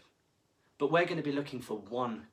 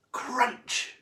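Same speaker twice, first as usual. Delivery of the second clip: high, loud crunch sound word; a loud playful chomp; big crunch; 12 semitones higher, 6 dB louder than usual. A narrator's reading. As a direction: bite down hard on the word. Shark bites the table.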